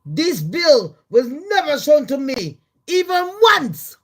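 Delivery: rising outrage on the last words; mock indignant Musk. Musk protesting to Trump about the bill, indignant. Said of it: "he's put".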